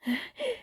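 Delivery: breathless high gasp; panting sigh. Acted. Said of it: "tried to catch his breath".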